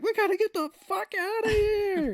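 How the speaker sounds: mocking the Elephant Man actor's panic; mock panicked shout. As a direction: squeal the escape line.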